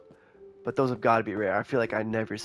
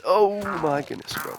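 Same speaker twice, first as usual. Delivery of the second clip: shocked exclamation; 7 semitones higher, 3 dB louder than usual. Real, not acted. Sudden shock at the terrain.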